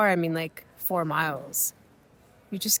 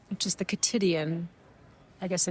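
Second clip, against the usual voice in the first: ordinary delivery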